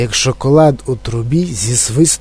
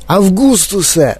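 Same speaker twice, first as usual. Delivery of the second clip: mock shout; 6 semitones higher than usual. A narrator's reading. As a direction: call out in agitation, held back. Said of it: "закричав містер Вонка". (стриманий стривожений крик)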